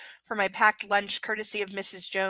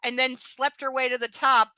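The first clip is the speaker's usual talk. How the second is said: sassy retort; 4 semitones higher, 6 dB louder than usual. Acted. Mocking retort to the narrator.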